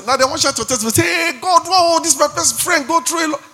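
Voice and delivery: high, sing-song mock of a fair-weather friend; mocking fickle friend. Preacher mocking friends who doubt God, high sing-song. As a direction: lampoon the false friend's prayer.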